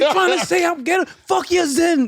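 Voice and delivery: high-pitched offended outburst; mock fury. Partner insulted by the other's calm, mock fury. Co-host joins acted angry partner.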